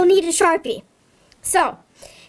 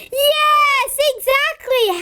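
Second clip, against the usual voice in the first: much higher, beaming yes; excited praise; 9 semitones higher than usual. Pretend. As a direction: cheer them on for the right rhyme. Praising kids' answer.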